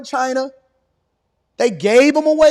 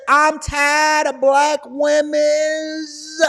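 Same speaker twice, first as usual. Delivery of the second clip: mocking quote; 4 semitones higher, much slower than usual. Mocking man's quoted words.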